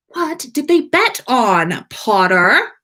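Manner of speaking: urgent demand. A narrator's reading. Draco demanding an answer.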